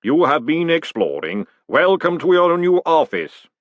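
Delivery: a ponderous clay giant announcing; booming golem. A narrator's reading. The golem welcoming Moist to his office, slow booming voice. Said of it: "boomed the voice of Mister Pump".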